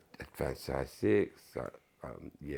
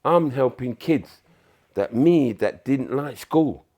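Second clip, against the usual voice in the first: emphatic; 7 semitones higher, 13 dB louder, slower than usual. Real, not acted. Emphatic pride in job.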